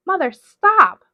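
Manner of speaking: loud, high cry to make her mother stop; desperate scream. A narrator's reading. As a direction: scream it with everything you have. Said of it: "screamed Hazel".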